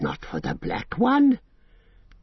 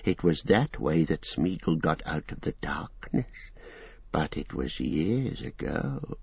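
lower, softer, slow secretive murmur; sinking lower; 6 semitones lower, 7 dB quieter, slower than usual. A narrator's reading. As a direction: draw them in with a hushed low voice. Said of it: "his voice sank even lower".

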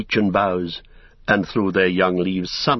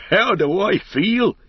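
high, drawn-out, elated exclamation; joyful cry; 10 semitones higher, 4 dB louder, slower than usual. A narrator's reading. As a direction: cry it out slowly, brimming with joy. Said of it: "he cried".

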